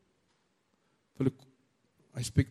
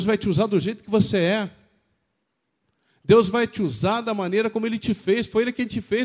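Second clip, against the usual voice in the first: sustained higher-pitched exhortation; impassioned preaching; 7 semitones higher than usual. Real, not acted. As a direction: press each point home to the congregation. Preaches insistently to the congregation. (pregação exaltada e insistente)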